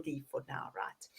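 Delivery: hushed conspiratorial whisper. Real, not acted. Host whispering to her co-host about the secret surprise. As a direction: breathe it softly like a shared secret.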